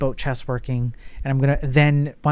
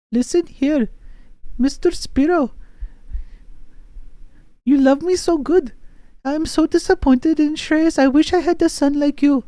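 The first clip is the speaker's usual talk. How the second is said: much higher put-on woman's voice; Shreyas' mom voice; 13 semitones higher, 3 dB louder than usual. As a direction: camp falsetto playing a friend's mother. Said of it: "Shreyas' mom is like".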